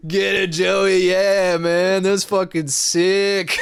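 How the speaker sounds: loud, slow cheering on; egging a friend on, hammed up; hyping up. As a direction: drunk teens cheering a friend dancing. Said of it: "fucking, like".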